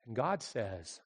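ordinary delivery

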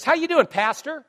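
high, loud, friendly greeting; chirpy greeting. Retold or imitated. Bank teller greeting the pastor, high and chirpy.

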